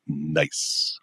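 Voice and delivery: low, hushed, drawn-out "nice" like a cartoon catchphrase; a slow, softly dropped South Park-style aside. Host quietly rewards himself for a win, South Park style. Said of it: "that would sound like a South Park".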